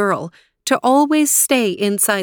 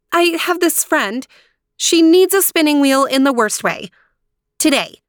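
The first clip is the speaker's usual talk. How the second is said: high, pressing appeal; pleading princess; 7 semitones higher, 4 dB louder than usual. A narrator's reading. Aurora pleading with the stranger for help, high and insistent. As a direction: press your case earnestly.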